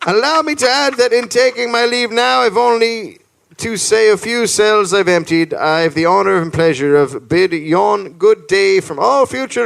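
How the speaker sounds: high formal declamation, falling at the sign-off; declaimed letter reading. Acted. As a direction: read a grand farewell letter, starting high and stepping down. Reads out a formal farewell letter.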